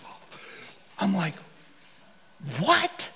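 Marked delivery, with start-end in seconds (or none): 2.6-3.1 startled what. Retold or imitated. Startled reaction.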